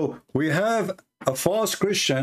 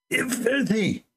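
Eh, filthy spat out much higher with contempt; hateful filthy; 9 semitones higher, slower than usual. Shown as a demonstration. Commentator showing how the imam spits out filthy, contemptuous. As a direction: sneer filthy like a dirty word. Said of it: "He did not just say filthy, like".

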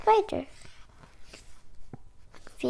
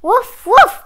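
loud, high playful woofs; dog barking; 10 semitones higher, 15 dB louder than usual. Acted. Voicing the dog for young listeners, loud barking. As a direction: bark the words out like a dog. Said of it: "barked the dog".

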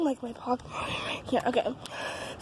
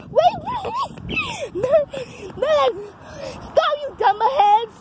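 much higher shouted call; 8 semitones higher, slower than usual. Real, not acted. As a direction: shout to make them stop. Shouts at friends running ahead.

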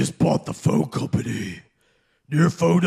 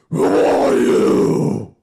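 very slow, louder, low demanding growl; slow menacing growl; 6 semitones lower, 6 dB louder, much slower than usual. Acted. Batman demanding the caller identify himself, slow and growling. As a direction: drag the question out threateningly.

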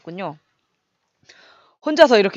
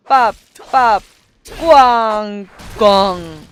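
loud punchy explosion sounds; mock bangs; 7 dB louder, much slower than usual. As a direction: voice each blast as it lands. Attack sound effects.

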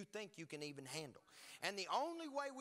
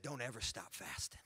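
low-pitched solemn charge; grave fatherly command; imitating warrior dad; 9 semitones lower than usual. Warrior dad charges his son about fasting, imitated solemn command. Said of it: "He said".

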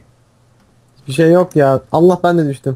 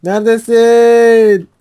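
high, loud, drawn-out calling; panicked calling; 7 semitones higher, 4 dB louder, much slower than usual. Real, not acted. Player hit by hacker calls friend, panicked cry. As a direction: call desperately for your teammate. Chased, calling teammate for help.

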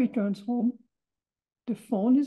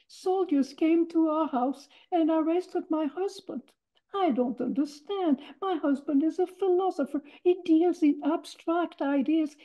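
mother pleading innocence; 6 semitones higher than usual. Retold or imitated. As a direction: play the worried wife's mock innocence. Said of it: "she said".

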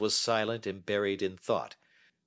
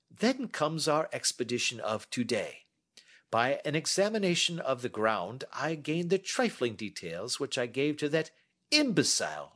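a touch higher, measured and assured; lightly voiced Holmes; 6 semitones higher than usual. Holmes inviting Watson to sit and listen, lightly voiced. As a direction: hold forth like a confident detective.

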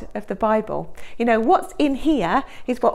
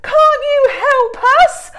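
much higher, louder, slow desperate plea; frightened shout; 11 dB louder, slower than usual. Acted. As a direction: shout to wake him up. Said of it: "they shouted at Jesus".

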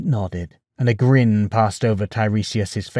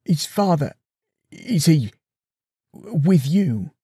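higher, halting question; hesitant; 5 semitones higher, slower than usual. Acted. Son hesitantly asks his mother's ghost, halting. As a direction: ask it afraid of the answer. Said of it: "Odysseus asked hesitantly".

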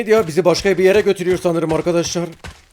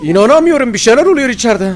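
high, loud worried cry; real alarm at the noise inside; alarmed exclamation; 9 semitones higher, 7 dB louder than usual. Real, not acted. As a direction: shout what's going on in there.

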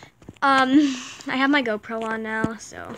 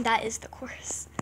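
whispering; 3 semitones lower, 9 dB quieter than usual. Real, not acted. Whispered aside after course.

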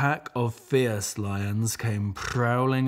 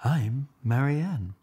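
light duck voice; 5 semitones higher, 3 dB louder than usual. Acted. Duck introduces herself.

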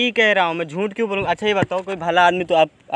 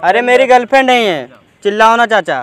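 louder, urgent explaining; loud pleading; 3 semitones higher, 9 dB louder than usual. Real, not acted. Prankster to the angry uncle: loud pleading. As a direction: talk over him to calm him down.

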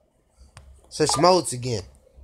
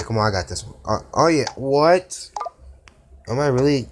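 usual speaking voice

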